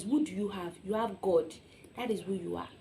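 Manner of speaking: ordinary delivery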